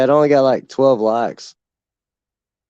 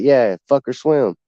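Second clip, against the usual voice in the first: normal talking voice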